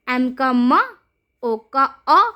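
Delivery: normal talking voice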